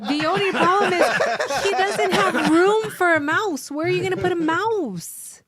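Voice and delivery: high laughing protest. Real, not acted. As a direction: laugh through it in disbelief. Amused protest about no room.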